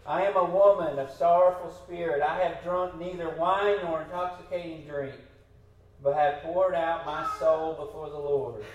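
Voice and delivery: loud, earnest Bible reading; scripture reading. Acted. Preacher reads Hannah's answer to the priest, loud and earnest.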